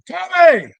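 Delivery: a high, loud call of Tommy. Retold or imitated. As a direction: yell the name like summoning from the yard.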